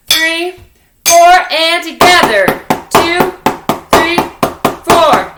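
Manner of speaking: loud counting. Shown as a demonstration. Teacher calling the beat for the class. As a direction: call the count over the drums. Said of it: "counting the class in".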